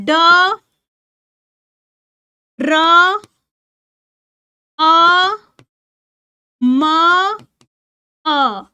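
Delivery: "ड, र, अ, म, अ" held slowly, long gaps between; sound by sound. Shown as a demonstration. Teacher splitting ड्रम for pupils to write, sound by sound. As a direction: give each sound of "ड, र, अ, म, अ" on its own. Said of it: "जैसे अब आप बोल रहे हो ड्रम".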